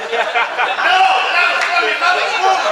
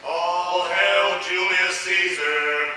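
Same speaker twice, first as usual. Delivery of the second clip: solemn proclamation; 7 semitones lower, much slower than usual. Acted. Ceremonial hail.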